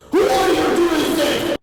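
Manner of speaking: loud, high, drawn-out yell. Acted. Mister Meter yelling at the working kids, loud and slow. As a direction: bellow it across the yard. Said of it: "asked Mister Meter".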